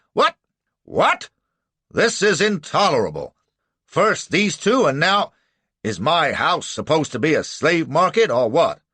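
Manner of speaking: high, loud spluttering outrage; pompous fury; indignant judge. A narrator's reading. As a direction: boil over with indignation. Judge outraged at slave catcher.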